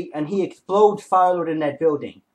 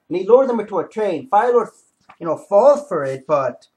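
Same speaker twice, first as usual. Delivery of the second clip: normal talking voice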